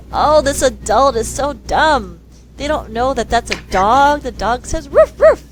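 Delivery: a know-it-all kid mocking the adult; smug child.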